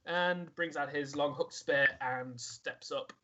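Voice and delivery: normal talking voice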